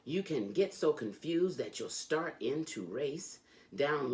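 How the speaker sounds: lively, sing-song storybook reading; reading to children. A narrator's reading. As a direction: read it like a bedtime book for kids. Reads Dr. Seuss to children.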